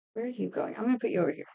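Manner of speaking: usual speaking voice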